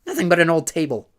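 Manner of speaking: high, loud dismissive crank voice; crank woman. A narrator's reading. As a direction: sneer at the empty room. Woman crank searching room.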